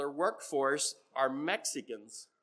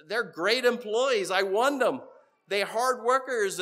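emphatic praise; 5 semitones higher, 7 dB louder than usual. Retold or imitated. Boss praising his workers.

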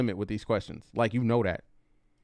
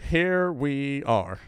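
slow, softer, drawn-out here we are; deflated resignation; 4 dB quieter, much slower than usual. Real, not acted. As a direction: let "Here we are" sink slowly and heavily. Resigned reaction to clip.

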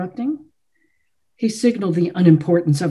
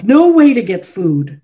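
angry, settling; 3 semitones higher, 7 dB louder than usual. A narrator's reading. Chopin finishing his accusation, loud and falling. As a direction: still loud but easing off, falling at the end. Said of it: "His eyes were afire".

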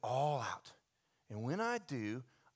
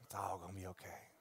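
calm reassurance; 7 semitones lower, 11 dB quieter than usual. Retold or imitated. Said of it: "is like".

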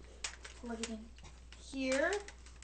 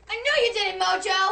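shrill angry yell, higher and louder; angry shout; 6 semitones higher, 5 dB louder, much faster than usual. Pretend. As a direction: shout it again at him. Repeated accusation at robot.

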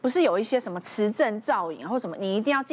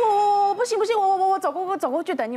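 high, louder, stammering in panic; retold panic, stammering high and louder; panicked stammer; 8 semitones higher, 3 dB louder, faster than usual. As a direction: stutter it out like you can't breathe. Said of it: "我一边结巴一边这样跟他讲".

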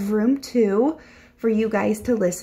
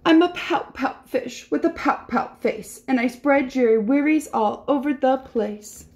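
higher, rhythmic pouty refrain; glum pouting fish; 5 semitones higher than usual. Acted. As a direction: chant it like a sulky little fish. Voices the gloomy fish's reply.

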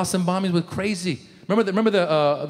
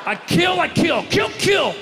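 Chechen uncle rant; 6 semitones higher than usual. Retold or imitated. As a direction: rant and shout like a wild uncle. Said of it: "Not the Chechens".

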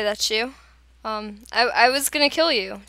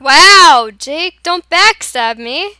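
shouting; 6 semitones higher, 12 dB louder, much slower than usual. Real, not acted. Yelling accusation at teammate.

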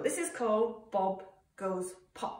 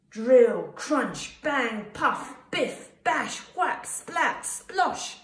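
loud bursts climbing in pitch; punchy sound effects; 4 semitones higher, 8 dB louder, much slower than usual. A narrator's reading. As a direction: punch out each noise, rising higher. Workshop noises read as sound words.

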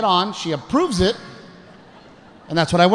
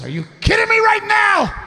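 high, yelled mock disbelief; hammed-up outraged shout; pissed wife; 3 dB louder than usual. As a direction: imitate an irate wife screeching. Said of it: "She was pissed".

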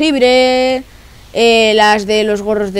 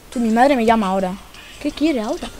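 ordinary talk, nothing marked

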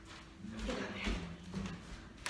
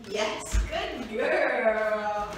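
laughing praise; 4 semitones lower, 5 dB louder, much slower than usual. Real, not acted.

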